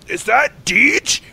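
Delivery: high, drawn-out mock call of recognition; imitated calling out. Imitated woman spotting driver from the back of the room, calling out. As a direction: call it slowly across a room.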